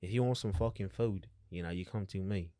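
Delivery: put-on lightly done North London accent; a hint of a cockney lad. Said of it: "you got the accent down".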